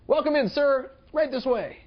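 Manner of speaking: bright high welcome, laid on thick; hotel clerk overdoing the welcome; overeager greeter. Retold or imitated. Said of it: "to say".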